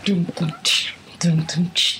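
low beatboxed drum and cymbal; low dums, whispery cymbal hiss; mouthed drum intro. Pretend. Mimics missing intro music.

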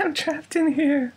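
high, slow, eerie plea; trapped little voice. Acted. Host playing trapped computer voice, high slow plea. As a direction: draw it out in falsetto.

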